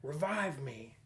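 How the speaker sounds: put-on heroic plea, hammed up; mock-dramatic game-character voice; Marcus Phoenix. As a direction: ham it up like a fallen action hero. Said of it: "imagining Mar-, Marcus Phoenix saying something, something, family".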